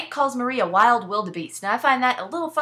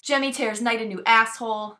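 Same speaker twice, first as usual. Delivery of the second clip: usual speaking voice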